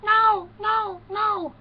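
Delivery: put-on high falsetto girly no; high loud falsetto protest; coy denial. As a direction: squeal it like a coy girl. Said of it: "she goes".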